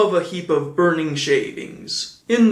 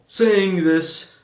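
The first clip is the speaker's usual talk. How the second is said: usual speaking voice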